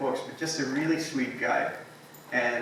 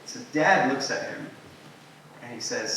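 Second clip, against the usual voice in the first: usual speaking voice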